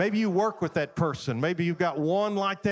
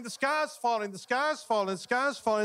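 raised-pitch retold alarm; retold yelling; 7 semitones higher than usual. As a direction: mildly cry the alarm like frantic storybook hens. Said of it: "they're all yelling".

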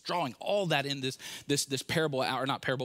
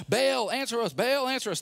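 higher, louder calling out; shouting; 7 semitones higher, 3 dB louder than usual. Retold or imitated. Prophets of Baal shouting for their god to answer. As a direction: call out to Baal pleading. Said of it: "they shouted".

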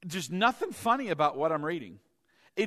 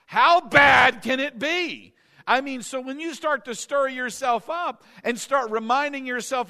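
raised, emphatic preacher voice; fired-up sermon exhortation; impassioned preaching; 5 semitones higher, 7 dB louder than usual. Real, not acted. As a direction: drive it home to the congregation, higher and louder. Preaching rises to full force.

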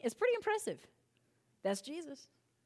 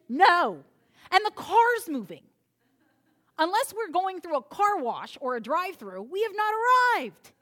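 exasperated parent; 6 semitones higher, 7 dB louder than usual. Acted. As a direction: snap back at the nagging kid. Exasperated parent answering child.

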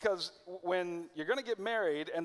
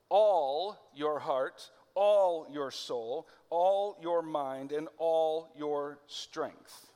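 each "all" punched louder and slow, rising; stress on all; 4 dB louder, much slower than usual. Shown as a demonstration. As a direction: hammer every "all", slowly and with weight. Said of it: "he's emphasizing the word all".

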